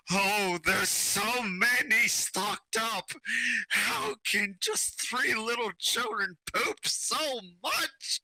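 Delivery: high-pitched despairing wail; screaming from afar. Acted. Blacksmith alone with three children's diapers, hammed-up high wail. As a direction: wail it in mock agony, stretched out. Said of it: "screaming from the blacksmith".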